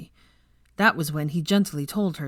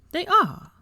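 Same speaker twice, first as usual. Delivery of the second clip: put-on a much higher young woman's voice, huffy; higher-voiced heroine, scolding; Hermione, indignant; 13 semitones higher, 4 dB louder than usual. A narrator's reading. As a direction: bristle at him with a high, prim retort.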